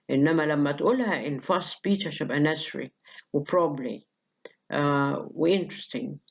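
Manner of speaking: ordinary talk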